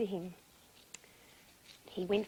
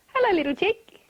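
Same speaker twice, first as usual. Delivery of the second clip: high, loud hen hello; lifted storybook character voice; mum greeting her chick; 8 semitones higher, 10 dB louder than usual. A narrator's reading. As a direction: sing out a bright greeting to a little one.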